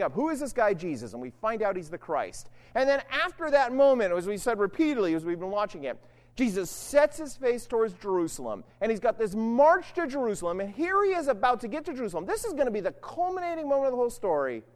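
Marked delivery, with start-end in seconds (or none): none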